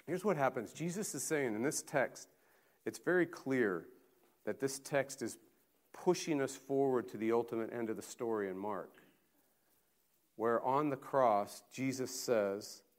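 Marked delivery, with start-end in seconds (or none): none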